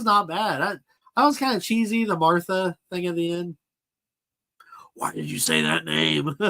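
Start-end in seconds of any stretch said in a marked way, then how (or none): 5.0-6.4 Ben Affleck's Batman. Retold or imitated. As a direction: imitate a gruff masked hero demanding an answer. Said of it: "he did not sound as cheesy".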